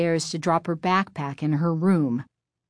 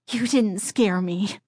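ordinary delivery

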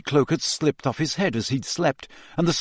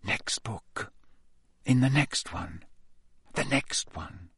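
exhausted murmur; 3 semitones lower, 9 dB quieter than usual. Acted. Exhausted self-urging.

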